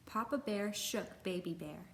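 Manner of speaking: ordinary delivery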